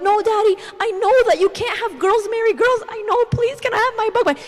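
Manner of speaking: put-on high, loud pleading little-girl wail; crying child. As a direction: imitate a daughter tearfully begging Daddy. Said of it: "And she's crying like".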